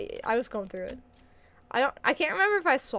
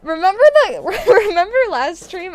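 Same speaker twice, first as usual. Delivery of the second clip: loud, high, laughing outburst of recognition; real excited laughter, louder and higher, rushing the words; 6 semitones higher, 11 dB louder than usual. Real, not acted. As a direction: burst in laughing, fast, as it clicks. Suddenly remembers kernel choking.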